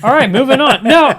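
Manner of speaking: loud high mock dismissal; hammed-up host moving on. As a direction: shout it to cut them off. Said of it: "when I used to be like".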